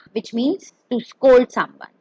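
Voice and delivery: ordinary talk, nothing marked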